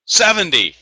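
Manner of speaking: high, loud excited welcome; excited intro. Real, not acted. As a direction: open the show pumped up. Excited show opening.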